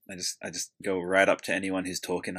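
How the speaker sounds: ordinary talk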